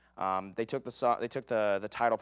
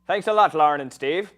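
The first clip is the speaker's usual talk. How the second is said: a loud, hammed-up stab at an Irish accent; bad Irish accent; 6 semitones higher, 11 dB louder than usual. Host thanks Lauren and Steve in a bad Irish accent. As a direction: thank the co-hosts in a clumsy Irish lilt. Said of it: "I'm not very good at my Irish accent".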